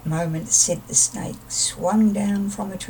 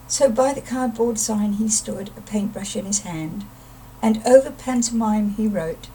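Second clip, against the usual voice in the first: plain reading voice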